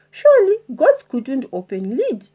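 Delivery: usual speaking voice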